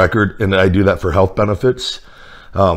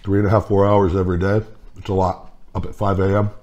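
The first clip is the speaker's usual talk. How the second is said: muffled chewing between words; talking while chewing. Real, not acted. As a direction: mouth partly full of food. Seen 3: Bit sandwich earlier; lips pursed, jaw working between words; napkin held.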